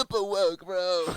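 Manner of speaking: high, loud stoner surfer; surfer dude voice. Retold or imitated. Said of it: "sounds like a skater dude slash surfer dude".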